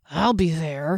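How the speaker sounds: growling dwarf voice; growled. A narrator's reading. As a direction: growl it as a threat. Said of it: "he growled".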